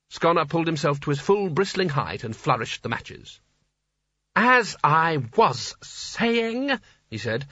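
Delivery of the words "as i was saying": high, very drawn-out irritation; pointed resumption. Acted. Sconner resumes his interrupted speech, pointedly exasperated. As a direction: stretch every word pointedly.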